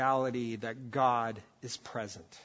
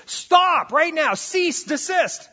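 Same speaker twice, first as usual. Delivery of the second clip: much higher and louder, slow, punching each word; stern parental shout; 13 semitones higher, 10 dB louder, much slower than usual. Shown as a demonstration. Parent to kids tearing each other's hair out, a slow stern shout. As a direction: yell it like breaking up fighting kids. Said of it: "a statement you would use if your kids were fighting".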